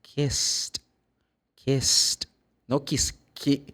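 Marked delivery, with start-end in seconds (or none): 0.0-2.3 kissed ending in a clear t; model kissed. Shown as a demonstration. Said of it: "the past is".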